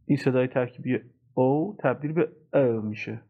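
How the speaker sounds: ordinary talk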